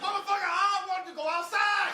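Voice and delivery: high, loud pissed-off pug voice; a furious little dog yelling to go out; angry pug voice. Pretend. Pug yelling at its owner, high and furious.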